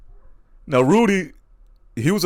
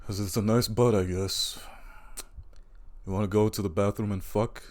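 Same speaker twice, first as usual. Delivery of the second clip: Rudy's cool voice; 4 semitones lower, 9 dB quieter than usual. Retold or imitated. Rudy propositioning Cassandra, low and careless. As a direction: toss it off like it means nothing. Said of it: "Rudy would just be like".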